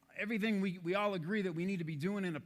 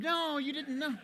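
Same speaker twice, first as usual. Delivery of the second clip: mock dreamy Chris; 7 semitones higher than usual. Speaker mimics a calm colleague apologizing to a waitress in a high voice. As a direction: apologize sweetly for the spilled food. Imitating Chris after spill.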